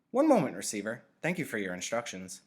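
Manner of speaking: high, loud intercom voice; speaker voice. A narrator's reading. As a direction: answer like a polite machine. Speaker voice continues.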